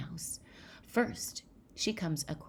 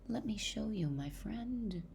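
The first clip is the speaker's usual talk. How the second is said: lightly voiced mouse, higher; coaxing mouse; 6 semitones higher, 3 dB quieter than usual. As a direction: coax gently in a small storybook voice. Mouse coaxes the Gruffalo child.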